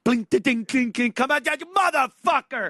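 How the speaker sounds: high, loud roulette frenzy; gambler yelling. Retold or imitated. Gambler screaming at the roulette ball, high and loud. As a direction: shout at the spinning ball. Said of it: "You hear that ball going".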